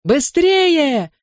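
high, stretched urging cry; calling out. A narrator's reading. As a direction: urge the runners on at high pitch. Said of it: "крикнул Джонни".

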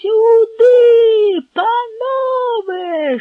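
very high, loud, drawn-out yell. Acted. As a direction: scream for help with all your voice. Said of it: "ще дужче загорлав".

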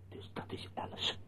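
whispered to himself; barely voiced realisation; stunned hushed whisper. Sudden realisation, hushed aside.

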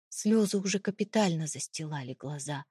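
usual speaking voice

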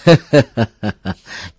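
loud, good-humoured staccato laugh; hearty chuckle. A narrator's reading. Mr Bảy laughs off the false alarm, hearty acted laughter. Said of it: "Ông Bảy Quá cười xòa".

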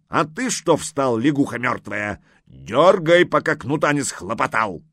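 high, loud threatening order; scolding. A narrator's reading. Old man threatening the dawdling servant lad, scolding. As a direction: berate the lad, building to a shouted command.

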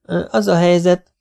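put-on high, eager child's prattle; lightly voiced chatterbox kid; chattering child. A narrator's reading. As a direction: start jabbering up high. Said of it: "karattyol Domi megint".

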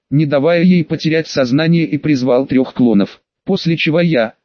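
ordinary delivery, nothing marked

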